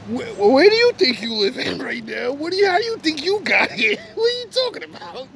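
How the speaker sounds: fast, climbing disbelief; incredulous challenge. Acted. Acted incredulous challenge to woman.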